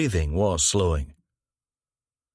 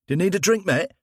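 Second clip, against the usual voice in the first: bystander voice; 11 semitones higher, faster than usual. Acted. Bystander offers help.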